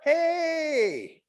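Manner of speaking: very high, breezy dog greeting; high-pitched, casual show-off dog; cheerful dog voice. Acted. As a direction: play a pleased dog hailing from a passing car. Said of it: "the dog is like".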